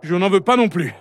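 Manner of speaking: put-on high-pitched, defiant young comeback; high, headstrong son's retort. A narrator's reading. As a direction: answer back stubbornly in a high voice. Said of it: "rétorqua Rumio".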